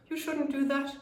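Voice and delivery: scolding parent. Shown as a demonstration. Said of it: "a parent who's telling the child off".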